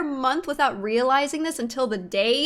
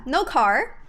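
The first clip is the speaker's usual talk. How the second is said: ordinary delivery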